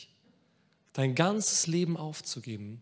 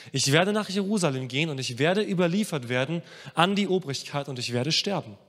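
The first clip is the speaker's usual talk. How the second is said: normal talking voice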